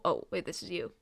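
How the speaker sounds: normal talking voice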